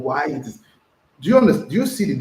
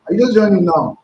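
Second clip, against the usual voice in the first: mock surprise; 7 semitones higher, 8 dB louder than usual. Real, not acted. Teasing a latecomer.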